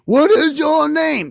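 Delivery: high, very loud, slow yell; exasperated Cyclops. Acted. Cyclops loses patience with Odysseus, high slow yell. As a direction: yell the question at full volume.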